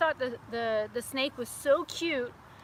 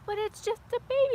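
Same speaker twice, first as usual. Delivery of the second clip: put-on whiny kid; 11 semitones higher than usual. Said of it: "I'm like".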